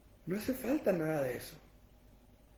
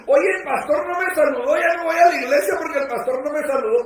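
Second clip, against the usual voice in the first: loud, high whiny churchgoer griping about the pastor, laughing; hammed-up offended member, high and loud with a laugh; mock complaint; 7 semitones higher, 13 dB louder, faster than usual. Feligrés quejándose del pastor. (feligrés ofendido quejándose del pastor, agudo y fuerte entre risas)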